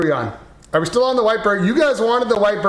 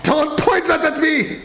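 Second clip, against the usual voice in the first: high, laughing panicked plea; 9 semitones higher than usual. Acted. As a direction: beg through laughter in a panic. Puppet at gunpoint, panicked repeat.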